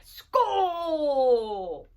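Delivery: mild Viking yell; a light hammed-up war cry; battle cry. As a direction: call it as you leap from the ship. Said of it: "with the battle cry".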